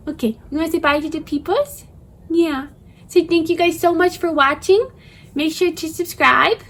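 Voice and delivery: put-on high cooing baby talk; puppy voice. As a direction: speak for the dog in baby talk. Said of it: "Sorry for my puppy voice".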